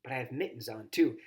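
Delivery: ordinary delivery, nothing marked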